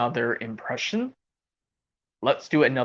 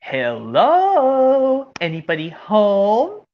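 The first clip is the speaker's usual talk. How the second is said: high, drawn-out hello; cartoon calling out; 4 semitones higher, much slower than usual. Cartoon visitor asking if anybody is home, calling out. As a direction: call out to see who is there. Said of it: "how about this impression where it goes".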